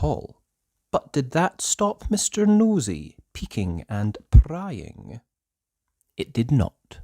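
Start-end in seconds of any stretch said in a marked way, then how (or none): none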